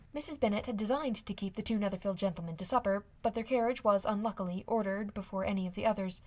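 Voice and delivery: ordinary delivery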